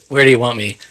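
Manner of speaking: usual speaking voice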